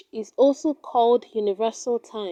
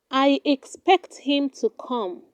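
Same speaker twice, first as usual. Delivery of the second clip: ordinary talk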